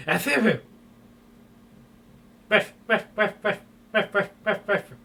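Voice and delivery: high yapping ref ref barks; yappy barking sneeze. Acted. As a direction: sneeze out into little yaps.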